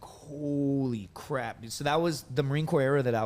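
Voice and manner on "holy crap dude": stunned disbelief. Retold or imitated. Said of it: "all of us are like".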